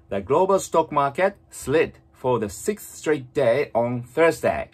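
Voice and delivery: slow, emphatic English model reading; read-aloud model. Shown as a demonstration. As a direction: read it out slowly for learners to shadow. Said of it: "最初に読みますから、". (シャドーイング用にゆっくり力強く音読)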